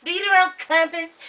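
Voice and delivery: high-pitched imitation Australian; teasing Australian impersonation, pitched high; mock Australian accent. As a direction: playfully put on an Australian accent. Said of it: "it's Australian".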